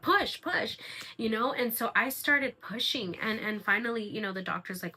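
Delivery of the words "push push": urging. Retold or imitated. Delivery team urging her on.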